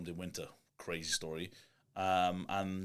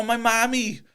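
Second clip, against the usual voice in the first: high, loud imitation of a broad valleys woman; much higher, louder Welsh valleys voice; broad Welsh accent; 13 semitones higher, 11 dB louder than usual. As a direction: ham up a valleys lady talking about her mammy. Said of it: "She's like".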